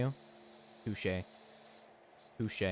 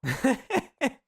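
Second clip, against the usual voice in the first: high loud laugh; 12 semitones higher, 8 dB louder than usual. Real, not acted.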